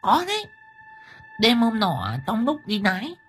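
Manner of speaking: high-pitched gossip giving a scary account; spooked village wife. Acted. Liên recounts a scary night sight.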